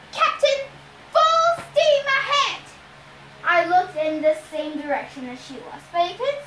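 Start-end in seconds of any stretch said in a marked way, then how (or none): 0.1-2.6 high, loud, slow command; calling out. A narrator's reading. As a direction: shout orders to the captain. Clarice calling orders.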